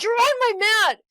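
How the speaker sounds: high, loud mock scolding; mock angry yell. Angry man guarding his yoga mat, mock yell. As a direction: bark it like a territorial gym guy. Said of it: "He's like".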